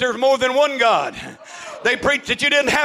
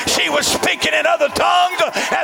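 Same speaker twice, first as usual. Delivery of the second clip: preaching at full force; 6 semitones higher than usual. Real, not acted. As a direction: build it up like a revival climax. Testimony climax preached at full force.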